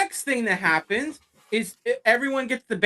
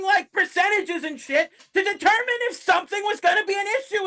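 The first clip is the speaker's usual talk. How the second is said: yelling; 8 semitones higher than usual. Real, not acted. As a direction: yell it out, exasperated. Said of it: "while I'm yelling".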